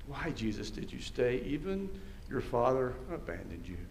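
soft, low and unhurried; hushed, tender. Acted. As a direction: ask and answer gently, well below preaching voice. Hushed question and tender answer.

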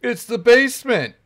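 high, loud squeal of discovery; shrieked realization. Real, not acted. Gamer squealing at the spooky basement. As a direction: blurt it out in a shrill panic.

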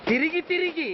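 normal talking voice

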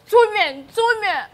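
"Two men" punched high and loud, twice; emphatic accusation. Acted. Maid exposes madam to Oga, "two men" hit high and loud. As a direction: hammer "two men" as a scandalous revelation.

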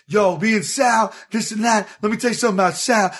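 imitated high-pitched rambling, speeding up at the end; wired fast talker. Crackhead bragging about his pal Sal, imitated wired rambling. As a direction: mimic a wired guy talking up his buddy. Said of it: "talk a mile a minute high on crack".

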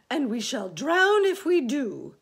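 high, loud wail of alarm; crying out. Acted. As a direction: wail it in protest. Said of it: "cried the earthworm".

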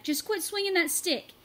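loud, high order; shouting order. A narrator's reading. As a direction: yell at him to stop. Marshall shouting at Chad.

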